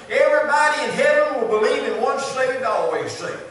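loud, rising preacher cadence, each line hammered; full-force preaching. Acted. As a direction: build the crowd up line by line. Pastor rises to full preaching.

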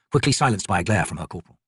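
ordinary delivery

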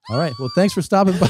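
high-pitched, projected host goodbye; mock a host cutting the interview short; TV host sign-off. Host abruptly ends the awkward interview.

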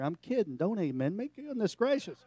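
normal talking voice

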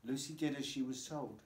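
usual speaking voice

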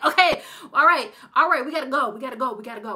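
loud, high, pressing call to leave; urgent hurry. Retold or imitated. Grandmother rushing her children out of town, urgent. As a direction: hurry the family out now. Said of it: "she's like".

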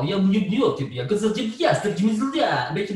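"je" with a thick, dark Russian L; "je" sung with a heavy, dark L. Shown as a demonstration. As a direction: chew on "je" with a heavy mouth. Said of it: "de Russische L".

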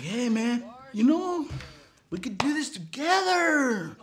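high, stretched-out pleading; wheedling proposition. Retold or imitated. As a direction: imitate a brother eagerly pitching himself.

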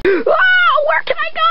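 high shrieking terror; panicked scream. Acted. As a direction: scream it, trapped and scared. Girl trapped, panicking.

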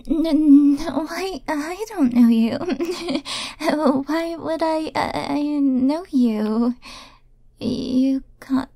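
halting, stammered denials with nervous giggles; nervous stutter. Acted. Caught admirer denies knowing darling, nervous stutter. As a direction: trip over first words, dragging them out. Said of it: "uh, stuttering?".

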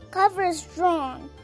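plain reading voice

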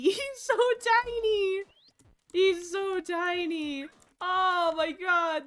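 overwhelmed by cuteness; gushing. Real, not acted. Gushing over tiny baby.

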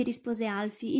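ordinary delivery, nothing marked

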